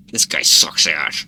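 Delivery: low, slow and grumbling; angry voice. Acted. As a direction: growl it out with a scowl. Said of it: "make an angry face".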